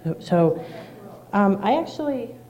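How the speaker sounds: real nervous voice before a speech; nervous shaking. Real, not acted. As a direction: barely get the words out for nerves. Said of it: "I'm, like, shaking right now".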